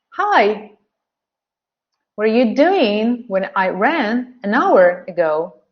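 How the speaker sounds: beats on "Hi", "doing", "ran", "hour"; content words stressed. Shown as a demonstration. Teacher reads the example for learners, content words stressed. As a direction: pitch lifts on "doing", "ran", "hour", small words weak. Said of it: "and they're stressed".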